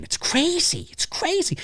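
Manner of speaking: high, soft, incredulous squeak; exasperated squeak. Real, not acted. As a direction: disbelief squeezed into a squeal. Exasperated exclamation.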